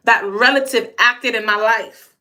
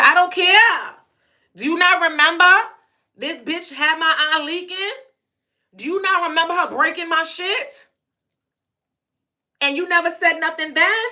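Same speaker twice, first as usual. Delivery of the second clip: heated comeback; 6 semitones higher than usual. Retold or imitated. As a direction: fire it back, voice jumping up high. Arguing back at upset mom.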